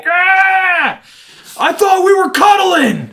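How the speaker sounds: high, loud, slow outraged cry; betrayed toddler wail. Retold or imitated. Dad voicing his daughter outraged at the car seat, high shout. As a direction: wail like a tricked little kid.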